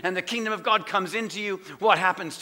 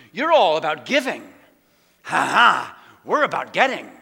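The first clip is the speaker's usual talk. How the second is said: mocking the world; 3 semitones lower, 7 dB louder than usual.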